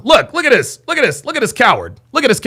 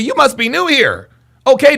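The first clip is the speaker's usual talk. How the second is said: normal talking voice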